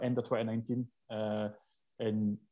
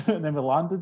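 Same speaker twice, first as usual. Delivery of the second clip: usual speaking voice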